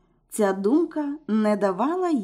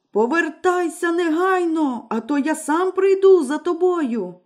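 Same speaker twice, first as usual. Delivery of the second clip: higher, impatient shout; villain yelling for his wife; 6 semitones higher than usual. A narrator's reading. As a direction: yell the threat at her. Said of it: "все кричав".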